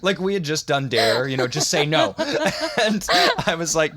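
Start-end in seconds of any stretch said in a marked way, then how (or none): none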